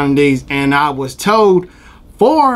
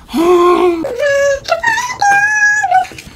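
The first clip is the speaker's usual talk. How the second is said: joyful wail. Acted. Overjoyed cry.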